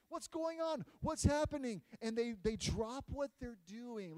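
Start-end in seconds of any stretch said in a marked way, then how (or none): 0.1-1.8 higher, louder puzzled questions; surprised townspeople. Acted. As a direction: sound startled by the news. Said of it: "They're asking questions".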